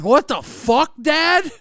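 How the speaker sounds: high incredulous What the fuck; shocked outburst. Retold or imitated. Son reacting to his dad's question, mock shocked. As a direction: blurt it out, stunned. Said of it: "So I'm like".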